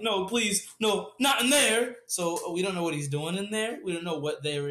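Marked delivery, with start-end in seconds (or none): 0.0-2.0 high, loud mock-panicked pleading; [name] pleading. Captive dragged behind a closed door, mock-desperate begging. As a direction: beg in hammed-up panic, stretching the last words. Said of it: "we hear [name] like".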